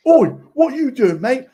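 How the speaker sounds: loud, high mock Pompey fan accent; bad Pompey accent. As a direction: call out like a Pompey lad, hammed-up accent. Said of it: "Sorry, a bad accent anyway".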